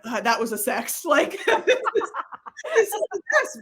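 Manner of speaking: high, loud laughing gush; laughing delight. Retold or imitated. Wife telling her friend the story, laughing high and loud. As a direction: laugh through the joy.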